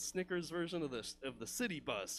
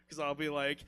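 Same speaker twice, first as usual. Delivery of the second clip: ordinary talk, nothing marked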